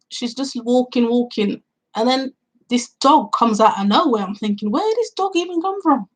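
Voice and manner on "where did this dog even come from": high, incredulous pitch jump; retold disbelief, pitched way up; baffled thought. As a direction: ask it like you can't believe it. Baffled inner thought about the dog.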